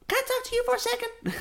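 very high, fast imitating a fourteen-year-old; mock teen girl. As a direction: squeak it like a serious young fan. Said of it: "She was like".

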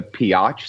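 "Piotch" with a mock "ah" sound, pee-otch; mock pronunciation of peach. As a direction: stretch peach into a silly "Piotch". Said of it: "it's actually the Piotch State".

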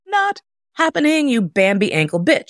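high-pitched, sassy cartoon refusal; talking ankles. Her ankles refusing to walk on the beach, mock defiance. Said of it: "My ankles are like".

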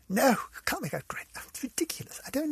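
unvoiced aside to herself; whisper. Real, not acted. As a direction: breathe it out under the breath. Muttering to herself about relations.

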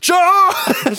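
high, loud charge yell; battle cry. Acted. Rider yells charge on pig.

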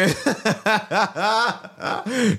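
long, high burst of laughter; real laughter. Real, not acted. Man interrupting his own point with laughter, high and sustained.

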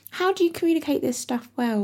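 put-on high cackly old witch; witchy voice. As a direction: say it like a witch crawling out of the forest. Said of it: "witchy voice?".

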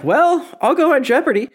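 high cartoon-mom impression; Marge. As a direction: put on a high cartoon mother's voice. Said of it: "And then Marge is like".

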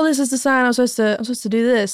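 normal talking voice

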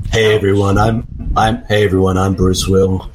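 low-pitched action star impression; Bruce Willis. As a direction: drop your voice low, like a film tough guy. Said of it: "channeling Bruce Willis in this scene".

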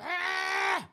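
very high, drawn-out mock scream; dramatic wail. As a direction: wail from the floor, overplayed. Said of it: "And then she's like".

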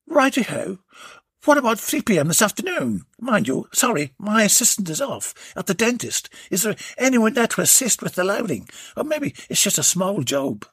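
high, chirpy tradesman; cheeky workman making plans; Warren's voice. Acted.

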